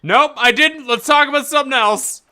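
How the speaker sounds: high, loud, hurried denial; hasty backpedal. Acted. Player backpedals to the game character, hurried high denial. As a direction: deny it quickly and change the subject.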